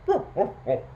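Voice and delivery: short, high woofs; dog barking. A narrator's reading. Storyteller voicing the dog among the animals, barking. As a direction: bark like a dog in quick bursts. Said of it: "and the dog barking".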